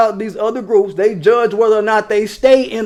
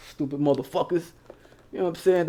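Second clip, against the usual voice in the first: usual speaking voice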